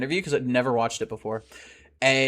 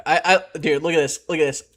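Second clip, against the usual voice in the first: ordinary delivery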